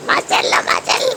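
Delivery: whispered mashallah. Real, not acted. Mother whispers mashallah to her baby, unvoiced.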